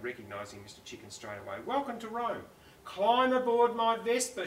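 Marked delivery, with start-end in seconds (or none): 1.6-4.5 Federica calling. Acted. Federica invites Mister Chicken onto her Vespa, higher hostess voice. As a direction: welcome him aboard with a flourish. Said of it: "she said".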